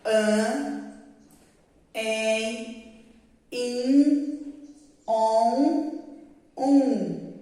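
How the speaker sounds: "An, em, in, on, um" each drawn out slowly through the nose; nasal vowels. Shown as a demonstration. Teacher demonstrating nasal vowels to second graders, slow and nasal. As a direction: sound each vowel "An", "em", "in", "on", "um" fully nasal, one by one. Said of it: "ele vai ficar com esse som, ó".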